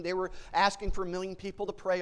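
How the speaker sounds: usual speaking voice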